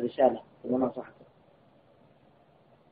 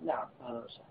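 hushed reply; 3 semitones lower, 17 dB quieter, faster than usual. Retold or imitated. Sheikh answers the repentant caller.

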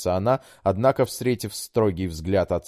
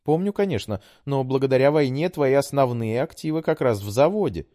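put-on high, pleading young woman; daughter voice; 8 semitones higher, 4 dB louder than usual. A narrator's reading. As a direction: coax like a sweet daughter. Daughter voice held.

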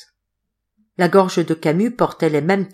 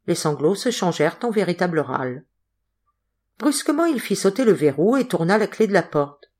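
usual speaking voice

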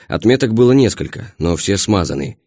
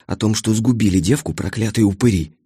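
breathy hiss through teeth. Acted. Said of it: "прошипела Любка". (шипящим голосом)

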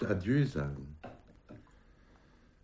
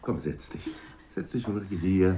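ordinary talk

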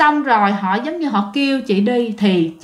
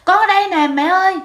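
crying out; 8 semitones higher than usual. Retold or imitated. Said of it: "chị cũng gào lên, chị khóc".

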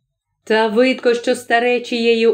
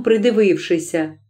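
ordinary delivery

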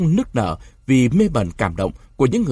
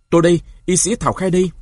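higher, louder and fast call; 7 semitones higher, 4 dB louder, faster than usual. A narrator's reading. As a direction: announce yourself to the frightened crowd. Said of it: "Thảo Khay nói to". (gọi to, nhanh và cao giọng)

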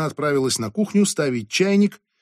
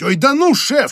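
almost yelling, much higher; near shout; 13 semitones higher, 7 dB louder than usual. A narrator's reading. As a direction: burst out nearly shouting. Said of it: "чуть не заорал он". (почти крик)

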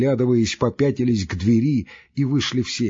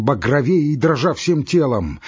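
ordinary delivery, nothing marked

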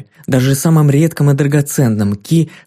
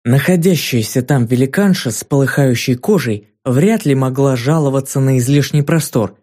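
usual speaking voice

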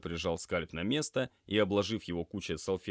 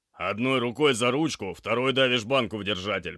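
loud, higher orders; forceful instructions; 5 semitones higher, 9 dB louder than usual. Acted. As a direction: drill a task into a green trainee. Medic drilling instructions into trainee.

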